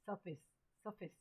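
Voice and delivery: "सरफेस" with the second vowel reduced to a soft schwa, no stress; schwa in surface. Shown as a demonstration. Teacher models the weak ending of "सरफेस" for students. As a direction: say "सरफेस" lightly, unstressed ending. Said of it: "stress नहीं, सरफेस".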